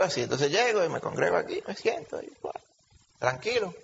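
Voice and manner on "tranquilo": ordinary delivery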